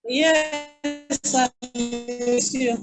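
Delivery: ordinary talk, nothing marked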